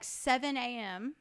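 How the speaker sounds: ordinary talk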